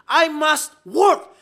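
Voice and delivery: slow, loud and high, each word weighted; emphatic quote. Acted. Preacher booming Jesus's words to the congregation, slow and loud. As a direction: declare it like Jesus with full force.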